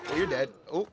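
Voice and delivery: normal talking voice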